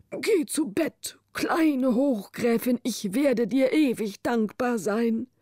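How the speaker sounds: slow, whispered good night; gentle. A narrator's reading. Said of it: "sagte sanft".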